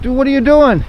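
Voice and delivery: alarmed. Retold or imitated. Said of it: "I'm like".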